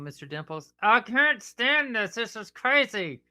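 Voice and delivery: ordinary talk, nothing marked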